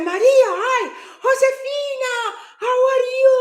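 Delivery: mock-squeal a delighted phone greeting; effusive greeting.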